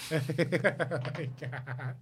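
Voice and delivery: helpless laughing through the words; drawn-out laugh into an exclamation; real laughter. Real, not acted. Podcaster cracking up at co-host's crying joke, laughing.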